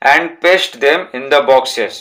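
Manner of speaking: each word slow and separate for the class; slow reading aloud. Shown as a demonstration. Teacher dictating a textbook sentence to pupils, slow and deliberate. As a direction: read it out slowly so pupils can write it down.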